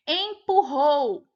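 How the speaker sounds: strong R in "Empurrou", loud and drawn out. Shown as a demonstration. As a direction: say "Empurrou" slowly with a forceful double R. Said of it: "R forte".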